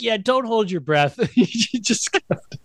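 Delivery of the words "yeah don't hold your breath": higher smug brush-off; imitating Pee-wee. Pee-wee dismissing Whoopi, a higher smug mock voice. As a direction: toss it off with childish cheek. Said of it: "He's like".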